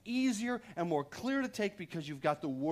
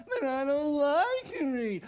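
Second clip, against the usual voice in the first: high, loud, drawn-out whine; hammed-up childish complaint, long stretched vowels; whiny voice; 7 semitones higher, 5 dB louder, slower than usual. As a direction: whine it at your mom. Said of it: "say it in a whiny voice".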